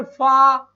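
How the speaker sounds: फादर said loud with a long aa; word demo. Shown as a demonstration. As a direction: model फादर for the class. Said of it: "क्या लिखा मैंने यहां पर". (फादर ज़ोर से, लंबा आ)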